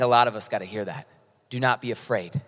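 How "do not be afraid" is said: ordinary delivery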